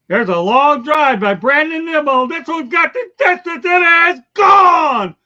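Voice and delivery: booming radio play-by-play, high and loud; home run call. Acted. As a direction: shout it like a broadcaster calling a walk-off homer. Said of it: "you wanna hear my home run call?".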